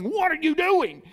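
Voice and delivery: preacher imitating a barking dog; dog's gruff bark voiced. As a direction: voice the unfriendly dog. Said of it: "it wasn't a very nice bark".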